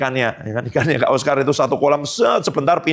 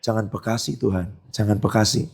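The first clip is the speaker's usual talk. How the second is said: softer and lower, pleading privately to herself; quiet inner plea; 8 semitones lower, 5 dB quieter than usual. Retold or imitated. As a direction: say it softly as a secret thought, not aloud. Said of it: "Tapi di pikirannya".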